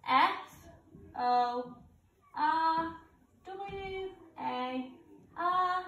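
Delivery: usual speaking voice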